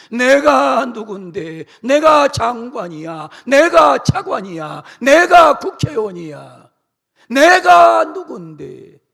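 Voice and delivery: mock politician shouting his rank; arrogant politician. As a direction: shout your titles at them.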